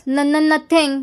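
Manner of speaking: usual speaking voice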